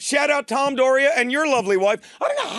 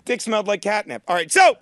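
ordinary talk, nothing marked

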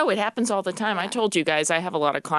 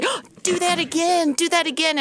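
much higher, eager retold voices; excited interviewers; 13 semitones higher, 3 dB louder than usual. Interviewers begging to hear the Southern y'all again. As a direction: play the amused interviewers asking for more. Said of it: "People would say".

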